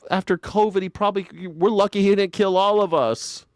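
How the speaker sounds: normal talking voice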